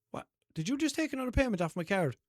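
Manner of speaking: asking in disbelief about the extra charge; confused. Retold or imitated.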